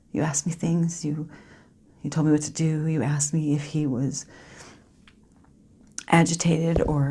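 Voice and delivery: very soft, halting recollection with long pauses; near-whispered dramatic monologue; grieving Lucy, hushed. Acted. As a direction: barely voice it, reliving the wait for the ambulance. Performs monologue as Lucy, partner dying.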